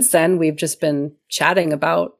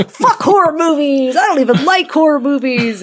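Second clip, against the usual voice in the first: higher, laughing mock rant; mock outburst; 8 semitones higher, 5 dB louder than usual. Friend mimicking Rob dissing horror movies, laughing mock rant. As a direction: play the sulky quitter for laughs. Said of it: "it kinda came out a little bit like".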